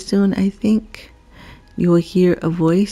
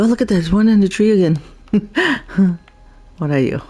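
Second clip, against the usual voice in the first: ordinary talk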